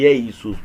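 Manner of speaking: "Jesus" drawn out slowly as ee-eh-SOOS, no rasped J; slow demo of "Jesus" without the J; Greek-style name. Shown as a demonstration. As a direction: stretch "Jesus" slowly, vowel-first, without the J. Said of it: "porque no existía la J".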